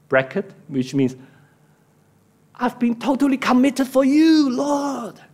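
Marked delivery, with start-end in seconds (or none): 2.6-5.2 high, impassioned protest; aggrieved feeling. Acted. Preacher as Elijah insisting on his commitment, aggrieved outcry. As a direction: plead your devotion, pitched right up. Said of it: "This is his feeling".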